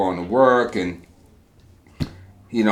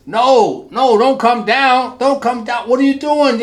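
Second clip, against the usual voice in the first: much higher, louder, slow frantic "no, don't come down"; alarmed protest; 7 dB louder than usual. Acted. Trapped man protesting the helper climbing down, high alarmed cry. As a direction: panic as he climbs in.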